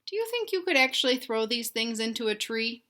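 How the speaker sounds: usual speaking voice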